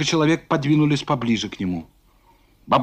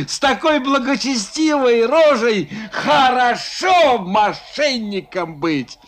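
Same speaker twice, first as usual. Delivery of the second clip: mocking rant; 5 semitones higher than usual. Foma mocks the merchants loudly.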